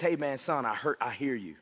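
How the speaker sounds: tender, hushed voice of comfort; tender reassurance. Acted. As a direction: reassure a hurting son gently. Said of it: "he says".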